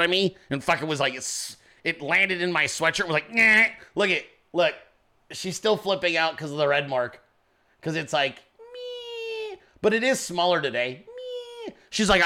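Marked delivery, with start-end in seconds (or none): 3.3-3.7 short nasty little "nyeh" of a stinging bug; imitated bug noise. Telling how the bug landed on his sweatshirt, imitated sting noise. 8.7-9.6 high, very soft thin "meee"; imitated tiny whine. Showing the red mark from the sting, tiny imitated whine.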